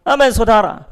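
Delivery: ordinary talk, nothing marked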